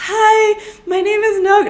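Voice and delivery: nervous fan introducing herself to industry people; nervous high greeting. Retold or imitated.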